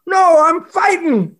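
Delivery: loud, high, drawn-out retort; mock defiant shout. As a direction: play the stubborn brawler, shouting high. Acted bar brawler's retort.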